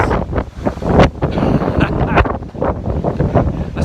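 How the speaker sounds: loud, high laughter in gale. Real, not acted. Laughing in strong wind.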